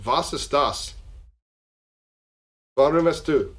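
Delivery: agitated German-speaking voice; German accent. Acted. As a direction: raise the pitch, demand it in German. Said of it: "and they go".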